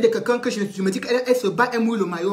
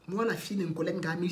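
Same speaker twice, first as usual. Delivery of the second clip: ordinary delivery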